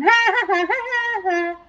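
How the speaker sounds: high, put-on cackle; tormenting laugh. Cow tormenting pig and turkey, high mocking laugh. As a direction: cackle teasingly, high in pitch. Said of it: "she's just tormenting 'em".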